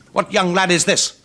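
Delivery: high, loud gruff challenge; a rough rascal sizing up a lad; Rascally Tag hollers at the newcomer; Rascally Tag's voice. Acted.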